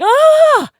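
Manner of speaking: high, loud, slow shocked gasp of disbelief; mock shock. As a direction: act aghast at the sight, shrieking it out. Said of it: "And then you're like".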